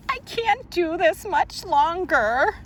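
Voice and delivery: high, wailing crying voice. Acted. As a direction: wail it out like you're in tears. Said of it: "read the crying one".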